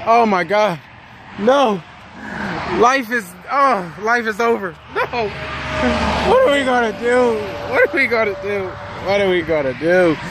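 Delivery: high, hammed-up despair fading toward the end; imitating a trucker panicking over a flat tire, pitched high; mock panic. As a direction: play a driver melting down over a flat. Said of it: "you go all crazy".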